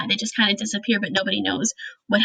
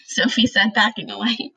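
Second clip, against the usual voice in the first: ordinary delivery, nothing marked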